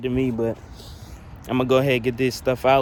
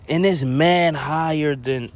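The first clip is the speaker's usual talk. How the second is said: excited; 5 semitones higher, 5 dB louder, slower than usual. Real, not acted. Comparing sub sizes.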